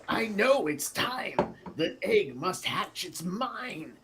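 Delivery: a hammed-up villain prince claiming the egg; greedy prince. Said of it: "the prince respond".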